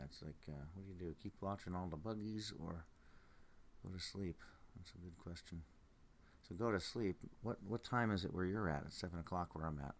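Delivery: ordinary delivery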